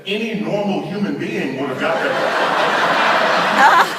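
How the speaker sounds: acted frustration. Frustrated wife needling her husband, slow mock exasperation. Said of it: "She's getting frustrated".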